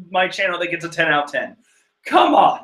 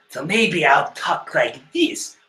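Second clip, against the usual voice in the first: slow put-on villain voice; trial character voice; slower than usual. Shown as a demonstration. Reader auditioning a voice for a manga character, slow and put-on. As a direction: try out a slow, altered character voice. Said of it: "What voice should I give Perrowan?".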